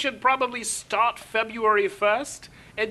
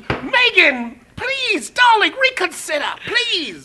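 high, theatrical begging; mock pleading; 8 semitones higher, slower than usual. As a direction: implore her with hammed-up desperation. Acted plea to Meghan.

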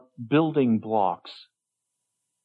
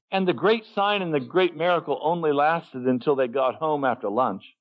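usual speaking voice

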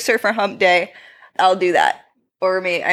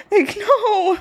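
high panicked shout; mock panic, high pitched; 13 semitones higher than usual. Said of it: "I'm like".

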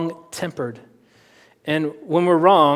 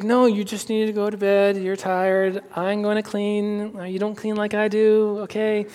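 a hint of a higher wife's voice insisting; higher, mildly firm wife sending him to bed; lightly voiced wife; 5 semitones higher than usual. Wife telling husband to go to bed, lightly higher and firm.

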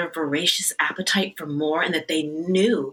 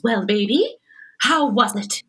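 fond hostess greeting; 6 semitones higher, 4 dB louder than usual. Retold or imitated. And she goes.

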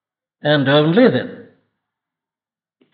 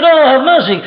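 desperate plea; 8 semitones higher, 5 dB louder than usual. Retold or imitated. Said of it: "He pleaded".

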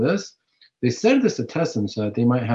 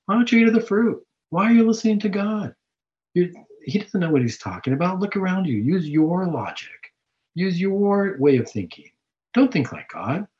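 serpent tempting Eve; 6 semitones higher than usual. Retold or imitated. The devil coaxing Eve toward the fruit, wheedling. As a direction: needle her into doubting God. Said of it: "in the book of Genesis when he talks to Eve".

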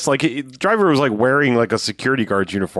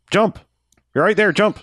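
urgent call, high pitch; 10 semitones higher than usual. Retold or imitated. Shouting to jump.

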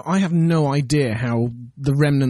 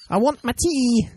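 mock Amy; 6 semitones higher than usual. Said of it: "you know".

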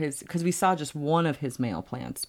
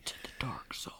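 whispering; 3 semitones lower, 14 dB quieter than usual. Pretend. Ominous aside about dark strains.

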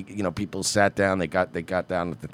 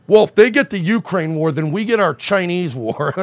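mock warmonger; 8 semitones higher, 4 dB louder than usual. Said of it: "I don't wanna use this, like".

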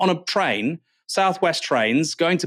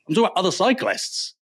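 ordinary talk

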